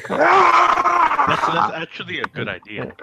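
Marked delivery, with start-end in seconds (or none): 0.1-1.4 long, high-pitched scream imitation; loud, sustained high shriek; mock full-out yell. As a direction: scream as if down the phone. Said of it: "I just yelled as loud as I could".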